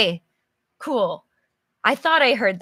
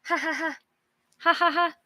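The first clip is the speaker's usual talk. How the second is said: light ha ha ha chuckle; a small laugh to yourself; 6 semitones higher, faster than usual. Shown as a demonstration. Teacher demonstrates a chuckle to the class. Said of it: "like, a small laugh".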